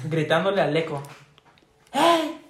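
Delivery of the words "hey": high yelled call to the echo; mock kid's yell. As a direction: shout it out like a little kid calling to the echo. Niño gritándole al eco. (grito agudo de niño imitado)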